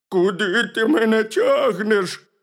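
high, loud bear whine; protesting bear cub. A narrator's reading. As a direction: protest loudly as a dragged little bear.

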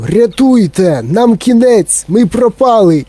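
high, drawn-out wail of doom; panicked cry. A narrator's reading. Grandpa Joe thinks they are doomed, crying out. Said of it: "дідунь Джо закричав".

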